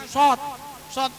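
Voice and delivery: "sot" said through pushed-out lips; rounded pouting lips. Shown as a demonstration. Teacher showing the letter sad, "sot" with rounded lips. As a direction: pout for a dark, rounded "sot". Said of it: "dibacanya mo-, monyong".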